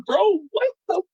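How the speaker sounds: shocked disbelief. Retold or imitated. Shocked reaction to creepy call.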